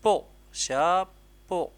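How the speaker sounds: slow spelling-out voice; syllable by syllable. Shown as a demonstration. Teacher to pupil, building the word slowly. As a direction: say "peau, chapeau" very slowly, syllable by syllable.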